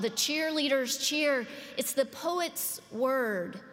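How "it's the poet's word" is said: normal talking voice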